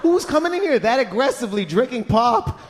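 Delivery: exasperated laughing. Retold or imitated. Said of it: "I go".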